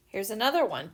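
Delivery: normal talking voice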